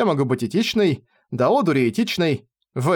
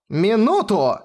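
higher-pitched indignant outburst; boiling over; 7 semitones higher, slower than usual. A narrator's reading. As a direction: flare up and cut in. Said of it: "вскипела я". (вскипев от возмущения)

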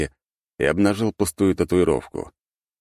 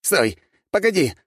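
high desperate cry to stop; shouting plea; 13 semitones higher, 3 dB louder than usual. A narrator's reading. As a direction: yell for them to wait. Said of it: "выкрикнул тот".

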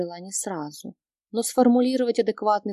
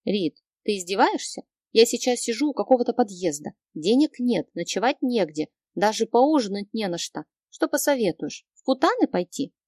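ordinary delivery

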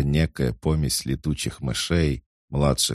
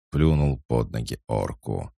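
plain reading voice

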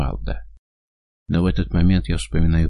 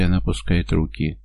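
ordinary delivery

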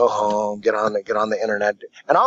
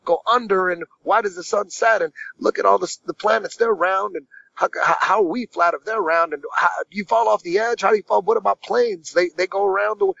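higher, raised pitch, baffled questions tumbling out; higher-pitched mock disbelief at a silly idea; incredulous newcomer; 8 semitones higher than usual. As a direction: play the skeptic who can't believe it. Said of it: "Me, I'm like".

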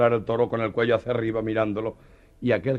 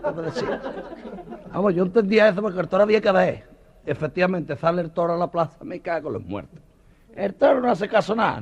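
higher, breathless telling; excitable banderillero; 8 semitones higher, 5 dB louder than usual. Acted. A banderillero telling the bullfight crowd, higher and breathless. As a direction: tell it like it just happened.

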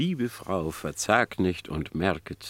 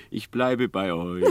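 ordinary delivery, nothing marked

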